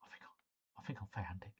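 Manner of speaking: whisper. Real, not acted. Excited discovery of the video.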